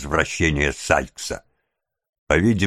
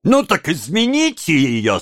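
high, taunting challenge; a touch of derisive amusement; mocking, amused; 9 semitones higher than usual. A narrator's reading. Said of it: "со смехом сказала девушка".

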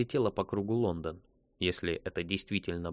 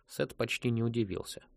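ordinary delivery, nothing marked

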